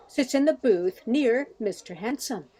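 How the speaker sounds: normal talking voice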